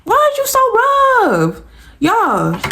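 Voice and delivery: squeaky, very high, slow voice of her own hair; her hair crying out. Pretend. Her hair begging the rough stylist in a squeaky high voice. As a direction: let the hair itself whimper in a tiny high voice. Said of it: "my hair is going like".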